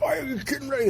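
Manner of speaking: high, incredulous retort; mad. Retold or imitated. Retelling his anger at workers bad-mouthing Steve, high incredulous outburst. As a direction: pitch it way up in disbelief. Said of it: "I got so mad".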